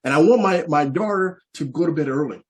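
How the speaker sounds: ordinary delivery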